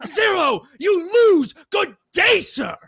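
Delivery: very high mock-outraged shout; Willy Wonka. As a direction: imitate a furious, theatrical dismissal. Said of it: "he's, like, yelling at them".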